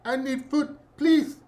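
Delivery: a thin, begging little voice; starving child's plea. Retold or imitated. The child in the ad begging viewers for food. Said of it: "they have him say something".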